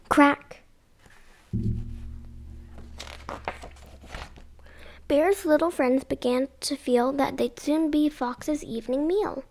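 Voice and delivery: lively sing-song read-aloud; storybook reading. Acted. Mum reading a picture book to kids, lively. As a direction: read it like bedtime story time.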